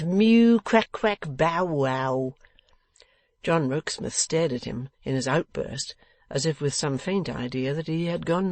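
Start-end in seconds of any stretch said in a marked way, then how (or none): 0.0-2.3 loud high mew sliding down to bow-wow; contemptuous animal mimicry. A narrator's reading. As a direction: jeer in run-together animal cries. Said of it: "retorted Mister Boffin with ineffable contempt".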